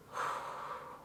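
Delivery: trembling sigh, held-back tears; shaky sigh. Real, not acted. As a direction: let out a shaky grieving breath. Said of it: "Sorry, this is still very fresh".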